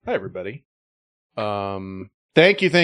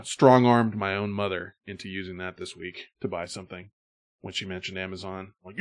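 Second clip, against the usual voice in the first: ordinary talk, nothing marked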